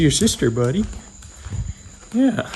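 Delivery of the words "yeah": high squeak of yes for the cat; squeaky cat yeah. Pretend. Owner squeaking yes for the cat, high.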